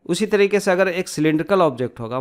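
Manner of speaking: ordinary talk, nothing marked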